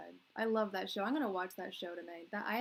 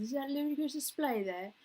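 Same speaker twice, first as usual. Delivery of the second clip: raised-pitch mock fan patter; football fan's complaint, milder than a yell; 5 semitones higher than usual. Sitcom character parroting football talk, put-on fan voice. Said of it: "what's the other thing he says".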